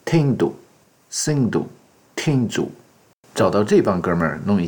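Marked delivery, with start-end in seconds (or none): 0.1-2.7 Tindu, T aspiré, voix montée et finale descendante. Shown as a demonstration. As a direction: prononce Tindu haut, en tombant à la fin. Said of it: "印度又称Hindu、".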